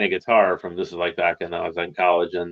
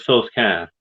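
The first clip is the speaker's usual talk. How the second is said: put-on strong Boston accent; 2 semitones higher than usual. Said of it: "it was so strong".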